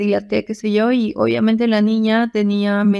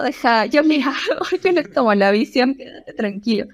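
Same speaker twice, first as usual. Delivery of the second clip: laughing while quoting; 3 semitones higher than usual. Real, not acted. Taking over, laughing.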